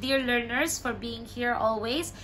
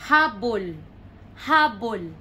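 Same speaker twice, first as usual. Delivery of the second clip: "habol" as HA-bol, loud HA; stress on first syllable; 5 dB louder than usual. Shown as a demonstration. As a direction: punch HA in "habol", meaning blanket. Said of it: "the stress is in the middle".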